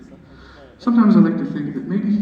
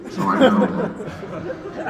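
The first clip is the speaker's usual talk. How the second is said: mock pedantic; 6 semitones higher, much slower than usual. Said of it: "I am oppressively pedantic".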